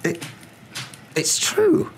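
high, halting, faltering voice; falter, then push the words out high. A narrator's reading. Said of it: "faltered Professor McGonagall".

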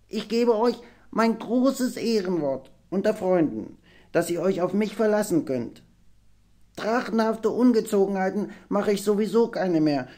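high, solemn small-dragon voice; squeaky half-dragon vowing loyalty; earnest little half-dragon. A narrator's reading. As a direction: make a heartfelt promise as a young dragon. Nepomuk's held character voice.

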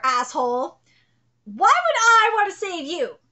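ordinary delivery

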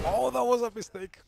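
high, loud cry of regret; dismayed exclamation. Real, not acted. Gamer reacting to his own costly mistake, loudly dismayed. Gameplay blunder, exclaims, then laughs.

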